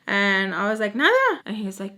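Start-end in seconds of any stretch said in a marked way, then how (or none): 0.9-1.4 high, loud retort; mock-innocent denial. Acts out own dismissive reply.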